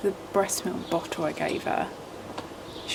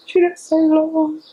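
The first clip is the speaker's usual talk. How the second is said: high, drawn-out doting coo; 9 semitones higher, 9 dB louder, slower than usual. Real, not acted. Cooing over her baby.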